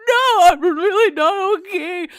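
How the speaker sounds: high, loud, drawn-out wail; mock crying. Juliet answering whether she's okay, exaggerated mock crying. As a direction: bawl it slowly, hammed up. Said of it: "so she's crying".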